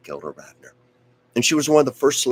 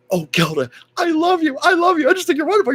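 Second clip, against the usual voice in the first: squealing high fan gush, much higher and louder; 7 dB louder, faster than usual. Retold or imitated. Young fan to Gilda Radner, high gushing squeal. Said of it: "'cause I was like".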